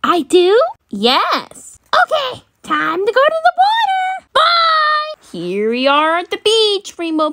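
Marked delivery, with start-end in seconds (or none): 1.9-5.1 high, eager cartoon voice; high-pitched playful farewell; excited toy puppy. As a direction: call it out as a thrilled puppy.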